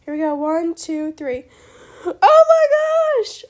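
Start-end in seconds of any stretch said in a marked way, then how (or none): 2.0-3.4 long, high, very loud shriek of joy; excited scream. Real, not acted. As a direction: scream it out, drawing out the words. Trade goes through, she is thrilled.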